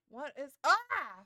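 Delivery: loud scream of fright; real jump-scare yell; startled scream. Real, not acted. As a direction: shriek as something jumps out. Startled by something in game.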